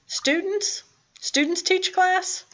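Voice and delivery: high, incredulous question; mock disbelief. Teacher mocking a silly answer to the class, high and disbelieving. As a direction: sound shocked that students would teach.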